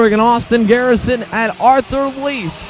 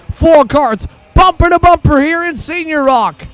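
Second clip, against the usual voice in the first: excited high race call; motorsport commentator; 4 semitones higher than usual. Real, not acted. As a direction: shout the pack closing up. Four karts bumper to bumper.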